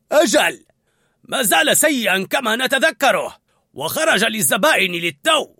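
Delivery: high, loud character voice; sous-chef reporting. A narrator's reading. Sous-chef to head chef on the dish, loud reporting. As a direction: report it up with force.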